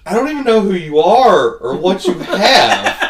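high, loud imitation breaking into laughter; mock exasperation. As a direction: holler it like a fed-up worker. Acting buddy's exasperated reply to customers.

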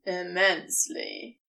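a smug demon granting it; low, lazy assent; amused serpent. A narrator's reading. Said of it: "Crowley grants".

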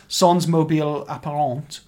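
French pronunciation of the title; French accent. Shown as a demonstration. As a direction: say it like a native French speaker. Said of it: "trying to do my best French accent there".

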